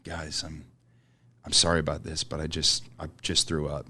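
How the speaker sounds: very soft, sheepish, mildly sad; sad apology. Retold or imitated. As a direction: mumble the confession quietly, ashamed. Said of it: "he's very sad looking at us like".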